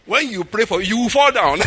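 loud, high, breaking into laughter; laughing warning. Real, not acted. Teasing a listener copying TV preachers, loud and laughing. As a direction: tease them, laugh bursting through.

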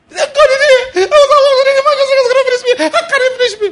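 shrieking high mock wail, very loud; hammed-up crying prayer. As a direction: cry out to God in exaggerated desperation. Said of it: "I heard their cry".

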